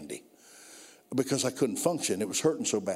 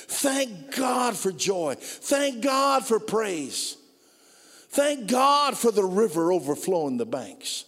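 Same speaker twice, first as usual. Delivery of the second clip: preacher hitting the sermon's climax; preaching at full force; 5 semitones higher, slower than usual. Acted. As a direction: lift the congregation, stretch every word. Crescendo of praise.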